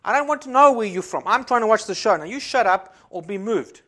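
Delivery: loud, high scolding retort; telling her off. Retold or imitated. Pastor retelling scolding a woman at the show. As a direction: snap back at the complainer. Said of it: "I said".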